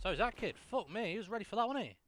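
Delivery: high, loud, incredulous; excited outburst. Real, not acted. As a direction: shout it in disbelief. Reacting to an enemy player.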